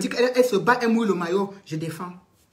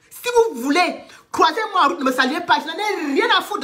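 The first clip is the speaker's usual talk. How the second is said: raised, higher voice, throwing the words at them; defiant outburst; 7 semitones higher than usual. Real, not acted. A livestreamer defiantly tells her critics she doesn't care, raised pitch. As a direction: dare them to ignore her.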